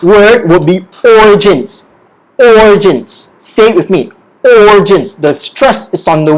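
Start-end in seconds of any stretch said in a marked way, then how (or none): 1.0-3.4 "origins" with a long, strong "or"; stress on OR. Shown as a demonstration. Teacher models next word, "origins" stressed on "or". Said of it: "is on the sound or". 4.4-5.2 "Origins" led by a strong "or"; stress on OR. Shown as a demonstration. Teacher has learners repeat, "Origins" stressed on "or". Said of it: "is on the sound or".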